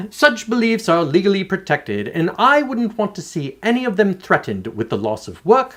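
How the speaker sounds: lightly put-on British accent, reading aloud. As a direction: read it in a mock posh British voice. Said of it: "maybe I should use my best British accent".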